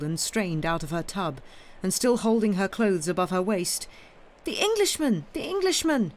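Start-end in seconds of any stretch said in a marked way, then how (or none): 4.4-6.1 put-on high child's shriek, panicked. A narrator's reading. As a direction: shriek it like a frightened little girl. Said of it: "she shrieked and fled away".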